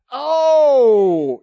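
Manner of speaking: high, loud drawn oh; a loud exclamation of awe; exclaiming. Acted. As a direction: burst out with wonder. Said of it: "preacher's exclamation".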